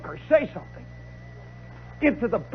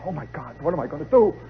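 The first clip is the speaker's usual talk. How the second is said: low, hushed worry to himself; sick with dread; 8 semitones lower, 3 dB quieter than usual. Acted. Lost sousaphone player inside the marching band, low sick dread. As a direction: sink into a quiet, helpless panic. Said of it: "and I'm sick".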